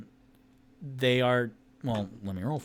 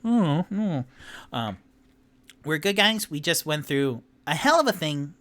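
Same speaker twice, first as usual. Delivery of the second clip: high squeaky voice; 5 semitones higher, 5 dB louder than usual. Acted. Tipsy explaining to halflings.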